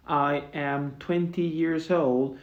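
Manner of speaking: slow, spelled-out English example; slow careful model sentence. Shown as a demonstration. Teacher modeling the correct form slowly for students. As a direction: lay each word out slowly for the class. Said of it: "tened cuidado con esto".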